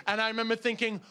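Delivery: ordinary talk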